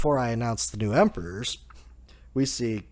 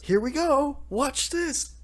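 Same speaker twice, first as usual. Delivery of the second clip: much higher, giddy cartoon voice; gleeful. Luffy hugging Kid as the fireworks go off, giddy.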